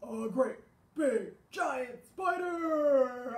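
slow giant boom; great big giant. Shown as a demonstration. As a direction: make the spider sound huge. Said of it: "but also".

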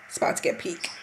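hushed, tense whisper. Real, not acted. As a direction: whisper it like a secret. Hushed tension before the match moment.

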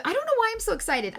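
high, fast and giddy; bubbling over like a little schoolgirl; giddy excitement. Real, not acted. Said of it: "giddy and happy like a little schoolgirl".

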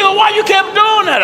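very high-pitched protesting question; mock bewilderment. Brother questioning the pastor who yelled along, mock bewilderment. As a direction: squeal the question as the baffled brother.

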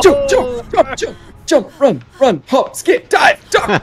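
high, loud frantic commands breaking into laughter; being chased. Real, not acted. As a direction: bark out escape moves in a panic. Fleeing the killer, calling moves.

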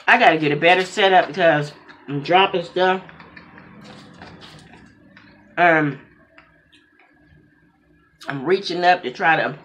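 chewing between words; talking through food; mouth full of noodles. Real, not acted. Seen 0: Noodles eaten at -5; cheeks and lips chew through ~9.7 s.

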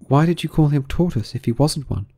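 high, questioning Alice. A narrator's reading.

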